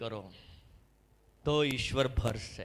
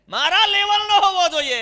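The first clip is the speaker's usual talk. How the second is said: much higher, far louder cry of the proud line; preaching shout; 13 dB louder than usual. Retold or imitated. Preacher mocking the proud to the church, shouting. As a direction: shout it out with full force. Repeated with full force.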